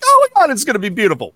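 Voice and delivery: high, rushed, hopeful gush; gushing excitement. Retold or imitated. Picturing lanterns over the water, gushing excitement. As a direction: gush as if it will be magical.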